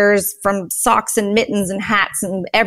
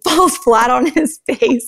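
ordinary delivery

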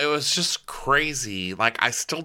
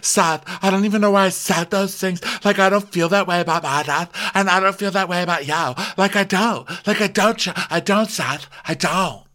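high, whiny imitation of pleading; hammed-up high-pitched distress; mock tearful plea; 9 semitones higher than usual. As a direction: plead in a high, badly faked crying voice. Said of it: "look, I'm crying".

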